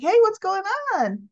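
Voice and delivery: high, sing-out mock greeting; [name], chirpy. [name] greeting the bubbly couple, overly cheery. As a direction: exaggerate a cheery hello, pitch jumping up.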